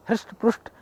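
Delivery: usual speaking voice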